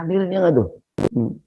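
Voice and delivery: ordinary talk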